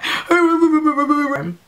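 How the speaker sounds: high blubbering babble; hammed-up blubber; mock hysterical sobbing. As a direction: imitate wordless, stuttering crying. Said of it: "I was crying, like, hysterically".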